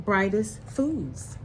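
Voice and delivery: plain reading voice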